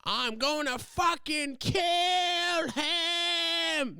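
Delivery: high, slow, drawn-out mock scream; imitating a freak-out. Vlogger imitating the Ozark girl's freak-out, hammed-up scream. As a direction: ham up the TV character losing it. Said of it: "that girl from Ozarks who, like, freaks out".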